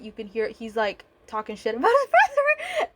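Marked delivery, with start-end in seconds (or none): 1.7-2.9 laughing. Real, not acted. Laughs about the brothers feuding.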